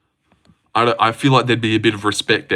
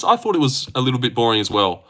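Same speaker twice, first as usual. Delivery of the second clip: normal talking voice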